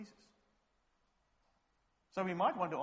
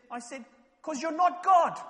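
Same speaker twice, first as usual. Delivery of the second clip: high, much louder cutting retort; sharp retort; 14 semitones higher, 15 dB louder than usual. Retold or imitated. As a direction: shoot him down flat. [name]'s sharp retort retold.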